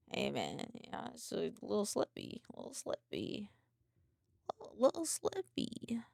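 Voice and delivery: hushed mock-sly murmur; soft, playful repeat, sinking towards a whisper; retold sly teasing. Acting out own teasing reply.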